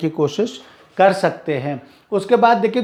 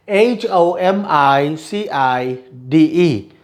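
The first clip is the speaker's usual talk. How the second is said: letters spelled slowly, one by one; slow spelling out; much slower than usual. Shown as a demonstration. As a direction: dictate each letter slowly for the class. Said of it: "मैं सिर्फ spellings पढ़ूंगा".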